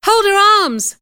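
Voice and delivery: high, loud shrill call; crying out. Acted. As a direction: shout it out in alarm. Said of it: "cried one of the girls".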